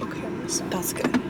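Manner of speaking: breathy near-whisper; soft aside while watching her drive; hushed approval. Real, not acted. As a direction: murmur it under your breath. Quietly watching Ava park.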